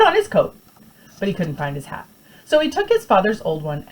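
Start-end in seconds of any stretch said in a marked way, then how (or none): none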